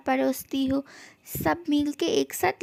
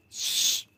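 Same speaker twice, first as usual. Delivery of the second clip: hushed unvoiced shh; soft shush; 4 dB quieter, much slower than usual. To his wife, quieting her protest, a soft shush. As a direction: hush them quietly.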